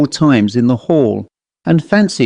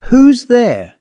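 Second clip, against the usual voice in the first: sharp, much higher cry of alarm; wild frightened call; 11 semitones higher, 4 dB louder than usual. Acted. Squire alone in the dark parlour fears a robber, calling wildly. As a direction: call out as if a robber stands there. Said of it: "the squire wildly called".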